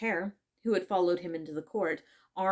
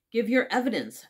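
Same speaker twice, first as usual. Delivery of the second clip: louder, raised-pitch angry order; 4 semitones higher, 7 dB louder than usual. A narrator's reading. King to the nervous Hatter, angrily. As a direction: demand it with some temper. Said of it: "the king repeated angrily".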